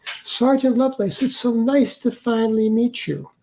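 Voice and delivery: high-pitched, soothing welcome in imitation of a therapist; put-on pastoral politeness, pitched well up; imitated priestly therapist. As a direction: greet like an over-kind counselor. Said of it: "like a priest or a therapist or something".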